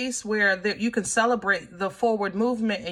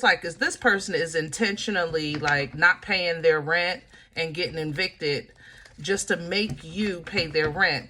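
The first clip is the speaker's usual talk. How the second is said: ordinary talk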